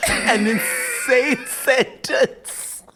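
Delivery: very slow, drawn out through laughter; laughing disbelief. Real, not acted. Cohost reacting to a ridiculous sentence, laughing. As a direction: stretch it out, cracking up. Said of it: "reaction to absurd plot line".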